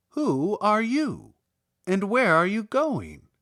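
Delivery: a hint of a stork's questioning voice. A narrator's reading. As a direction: ask like a bird meeting strangers.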